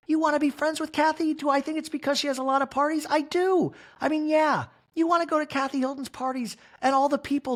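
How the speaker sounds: a hurt, high-pitched complaint; hammed-up Kyle impression; mock Kyle.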